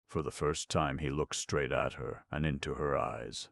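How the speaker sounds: ordinary talk, nothing marked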